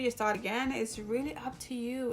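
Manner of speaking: ordinary delivery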